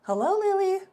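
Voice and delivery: high, lilting Hello, Lily; playful high-pitched Hello, Lily; sing-song hello. Acted. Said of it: "I mean, hi-lo".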